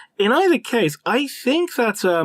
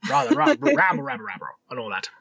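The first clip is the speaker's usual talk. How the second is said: mock British bluster; 8 semitones higher than usual. Host sending up British small talk, mock British bluster. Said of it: "Don't we sound very British?".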